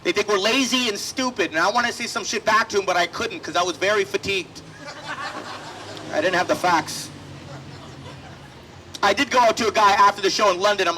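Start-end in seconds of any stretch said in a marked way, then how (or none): none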